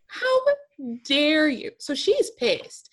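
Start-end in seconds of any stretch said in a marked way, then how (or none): 0.1-1.7 HOW jumps very high, outraged; mock outrage, much higher on How; indignant how dare you. Real, not acted. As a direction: throw HOW up high in disbelief. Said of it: "repeated how dare you".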